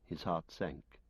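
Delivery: usual speaking voice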